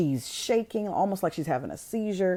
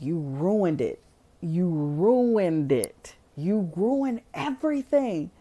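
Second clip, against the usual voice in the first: slow, drawn-out accusing wail; mock fury, dragged out and rising; acted angry accusation; slower than usual. Retold furious accusation before attacking.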